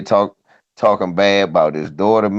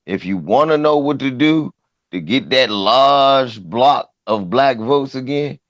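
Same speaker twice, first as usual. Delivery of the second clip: normal talking voice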